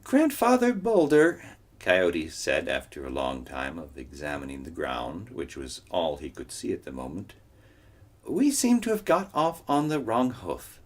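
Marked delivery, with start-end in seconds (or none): 0.0-1.4 high, bright trickster voice; high-pitched cartoon coyote. Coyote voice in read story. 8.2-10.8 high, bright trickster voice; high-pitched cartoon coyote. Coyote voice in read story.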